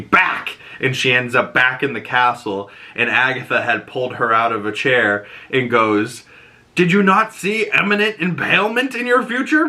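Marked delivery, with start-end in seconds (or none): 0.0-0.6 short high cry of one word; mild mock scream, pitched up; retold scream. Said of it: "we see Lilia scream". 6.7-9.7 high-pitched, exasperated telling-off; retold scolding, voice rising high in disbelief; incredulous scolding. Retold scolding after near miss.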